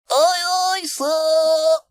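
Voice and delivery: squeaky-high, loud monkey sailor; very high, loud shouted reply; calling back. A narrator's reading. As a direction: call back smartly to your captain. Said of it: "called Mike".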